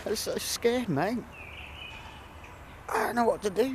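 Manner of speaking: high, trembling plea to a lost friend; frightened and close to tears; scared. Real, not acted. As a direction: voice climbing with fear. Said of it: "God, I'm scared".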